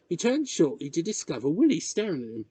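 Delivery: ordinary delivery, nothing marked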